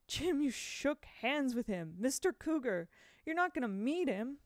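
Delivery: high, loud, agitated protest; sputtering. A narrator's reading. Boy confronting his friend over the handshake, sputtering. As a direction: sputter in alarmed disbelief, stretching the key words. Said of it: "Aside, Will sputtered".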